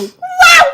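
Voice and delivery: very high, loud squeal; flung rat squeal. Retold or imitated. Game master as rat flung past hunters, high squeal. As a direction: squeal like a rat thrown into the air. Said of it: "see this little rat body go".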